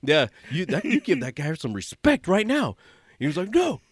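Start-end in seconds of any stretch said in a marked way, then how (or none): 0.0-2.8 loud, high laughing telling-off; scolding. Retold or imitated. Speaker scolding a coworker to show respect, loud laughing rebuke. 3.5-3.8 high furious screaming, much louder; screaming in rage. Retold or imitated. Coworker screaming at the speaker that he hopes the veteran dies, furious scream. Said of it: "He's, like, screaming".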